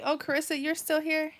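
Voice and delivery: light surprise at a viewer still watching; surprised greeting. Real, not acted.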